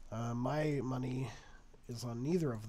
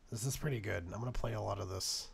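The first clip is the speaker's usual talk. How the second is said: normal talking voice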